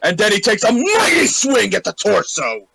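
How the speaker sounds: loud, high, shouted storytelling; rousing combat description; excited action narration. Acted. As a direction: narrate the big blow at full volume. Player narrates the charging blow.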